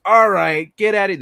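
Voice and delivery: hammed-up police raid yell; mock cop bust-in. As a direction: burst in like a cop. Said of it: "They're like".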